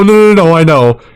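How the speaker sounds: usual speaking voice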